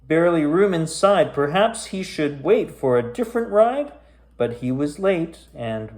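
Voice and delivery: spooky rhyming storybook read; story narration. A narrator's reading. Reading a creepy rhyming tale to kids, storyteller voice. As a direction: read it aloud like a bedtime ghost tale. Reads a spooky rhyme aloud.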